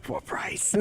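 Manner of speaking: unvoiced whispered aside; mock Walken whispering the catch. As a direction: hush the catch. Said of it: "Hey, Chris".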